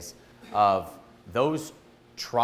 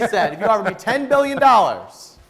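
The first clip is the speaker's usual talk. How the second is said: laughing; 12 semitones higher, 11 dB louder than usual. Real, not acted. Excited retelling of [name]'s answer.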